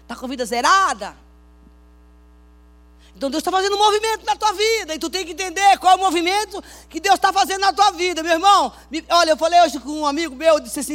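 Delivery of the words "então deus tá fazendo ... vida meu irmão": loud, high urgent exhortation; fervent pulpit cry; preaching at full force. Acted. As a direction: drive the point home to the congregation.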